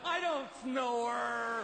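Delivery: slow, drawn-out mock denial; indignant denial. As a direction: play the wife insisting, stretched out. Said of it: "they're like".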